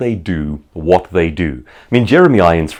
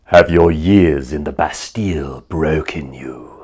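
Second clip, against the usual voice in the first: Jeremy Irons impression; 4 semitones lower, slower than usual. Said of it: "say something like".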